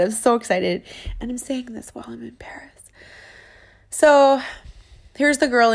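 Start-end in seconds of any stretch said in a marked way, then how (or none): 1.2-2.8 unvoiced, much softer aside; soft secret whisper. Real, not acted.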